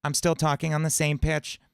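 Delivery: bright high-larynx cartoon squeak; SpongeBob voice. Shown as a demonstration. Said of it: "So it sounds like SpongeBob".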